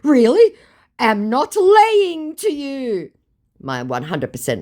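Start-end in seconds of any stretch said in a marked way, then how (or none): 0.0-3.1 high, loud, drawn-out hammed-up denial; mock scammer protest. Imitating the scammer denying he lies, hammed-up and high. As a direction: ham up the scammer's outraged denial. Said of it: "my mugu says".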